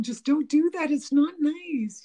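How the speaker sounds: worked-up, half-playful protest; exasperated plea. Retold or imitated. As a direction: plead with him to quit teasing. Telling off her teasing husband.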